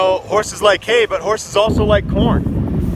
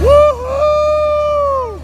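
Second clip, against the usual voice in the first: high, loud woo-hoo; woo-hoo shout; 8 dB louder than usual. Real, not acted. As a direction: belt out a big woo-hoo. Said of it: "Give me a big woo-hoo".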